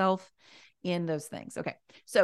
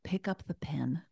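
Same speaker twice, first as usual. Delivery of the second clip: whispering; 2 semitones lower, 6 dB quieter than usual. Shown as a demonstration. Coaching the listener through a task.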